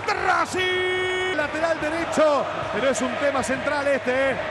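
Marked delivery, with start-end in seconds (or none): 0.1-1.3 stretched, shouted goal call; drawn-out goal call. Real, not acted. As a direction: drag out the team name.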